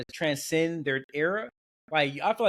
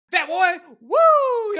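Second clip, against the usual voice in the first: high, loud heel-promo taunt with a drawn-out woo; Ric Flair; 14 semitones higher, 10 dB louder, much slower than usual. Retold or imitated. Speaker imitating a wrestler working the crowd, loud heel promo. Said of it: "a heel promo talking about".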